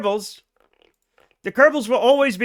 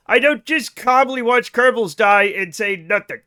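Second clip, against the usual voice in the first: normal talking voice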